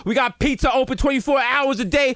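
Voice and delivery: high, shouting entitled New Yorker; mock tirade. Entitled New Yorker demanding the manager, a rising mock yell.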